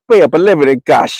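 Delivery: high-pitched imitated Jamaican accent. The guy demanding payment, in a Jamaican accent. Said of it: "It's with an accent".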